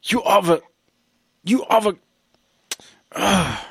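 high, broken-off attempts at the word; can't get it out. Acting the son who cannot insult his dad, struggling for words. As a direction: choke on the word, pausing between tries. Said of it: "I don't even think he can get the words out".

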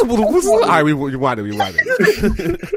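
helpless laughing. Real, not acted. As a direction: squeeze the words out while cracking up. Host still cracking up.

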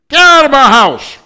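screamed, high and loud; angry parent's shout. Acted. Furious parent shouting at the broken child. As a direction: throw the child out in fury. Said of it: "What do you do?".

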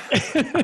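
a real, high, bubbling laugh; burst of laughter. Real, not acted. Dad laughing at his daughter's funny remark, real laughter. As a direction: laugh out, caught off guard.

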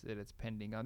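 usual speaking voice

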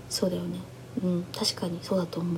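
quietly acted-out giving in, low and soft; subdued agreement. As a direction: imitate someone meekly conceding, under your breath. Acts out her quiet giving in.

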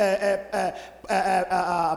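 ordinary talk

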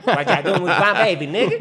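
hammed-up indignant mom; angry mother. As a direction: confront him about her baby. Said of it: "Es que es negra".

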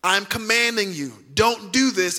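slow, measured imitation of a stern command; stern warning. Preacher voicing Jesus commanding the healed man, sternly. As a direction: lay down a firm order, word by word. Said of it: "Jesus sternly warned him".

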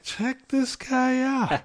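slow, high mock aside of the judge; judge's amused aside. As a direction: imitate the judge's amused disbelief. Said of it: "She's like".

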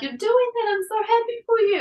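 very high, tearful joy; teary midwife. Retold or imitated. Midwife crying with joy to the mother, very high tearful. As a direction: choke out happy praise. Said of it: "started crying, and she was like".

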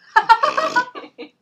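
loud high burst of laughter. Real, not acted. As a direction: cracks up laughing.

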